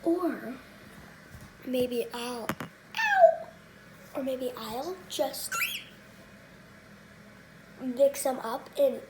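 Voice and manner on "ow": sudden high ow; sharp yelp of pain. Real, not acted. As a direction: yelp from a bite. Bird bites her.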